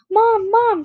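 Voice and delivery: high, loud call for mom. A narrator's reading. Mallory calls her mom, high and loud. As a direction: call out to mom across the house. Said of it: "she cried".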